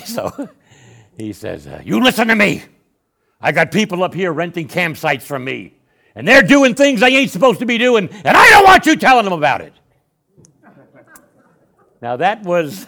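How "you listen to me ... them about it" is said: high, loud tirade building to a yell; acted irate businessman, shouting in his face; freaked-out angry shouting. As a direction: lay into someone at full volume. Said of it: "he was really freaking out".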